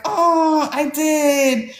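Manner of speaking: high, drawn-out mock guilt; sheepish admission. Author admitting it to her upset brother, high mock-sheepish voice. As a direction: own up in a small high voice.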